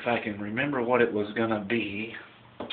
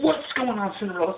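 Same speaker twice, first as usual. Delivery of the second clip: high, scolding fairy godmother; 11 semitones higher than usual. Retold or imitated. Godmother scolding late Cinderella, high-pitched. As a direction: demand an answer, pitched up high. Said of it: "She goes".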